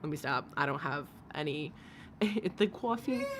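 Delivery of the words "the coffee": put-on playful New York accent. Streamer playing at being from New York, New York accent. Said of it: "New York".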